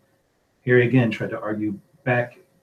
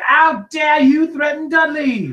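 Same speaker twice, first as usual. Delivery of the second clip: very high, slow, furious shout; strained, drawn-out bellow; roaring; 7 dB louder, slower than usual. A narrator's reading. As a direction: roar it in outrage. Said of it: "roared Uncle Vernon".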